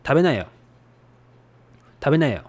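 standard Tokyo "Tabenayo"; Tokyo dialect. Shown as a demonstration. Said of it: "Tokyo dialect is".

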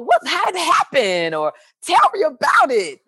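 high, excited prodding; imitating an eager phone call; retold eager interest. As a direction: beg for the news, voice lifted high. Retells eager questions on phone.

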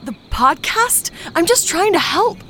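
high, loud, pleading; desperate, high-pitched pleading; frightened protest. Acted. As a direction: defend yourself in a panic. Victim protests to attacker.

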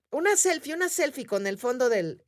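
high imitated eager request. Friend asking the group for a selfie, eager. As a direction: mimic an excited friend asking.

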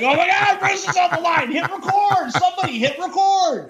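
very high, hammed-up excited cry; mock excitement. Co-host teasing his partner over recording every call, mock excited. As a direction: imitate someone frantically calling the room to action. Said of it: "get all excited".

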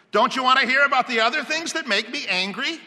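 high, loud, fast plea; eager insistence. Acted. Homeowner just getting started detains researcher, insistent.

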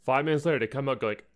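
normal talking voice

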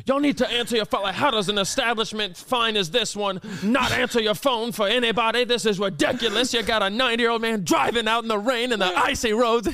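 high, indignant running rant; imitating an old man preaching at staff; mock-irate grandpa on a tirade; angry sermon. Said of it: "giving a sermon".

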